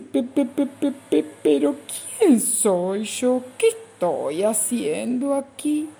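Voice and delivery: high, stuttering little voice; frightened little bug stammering. Acted. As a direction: trip over the first word, lost. Frightened Bichito stammers questions.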